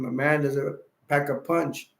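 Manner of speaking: ordinary delivery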